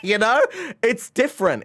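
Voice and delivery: high laughing. Real, not acted. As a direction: giggle it out high and bright.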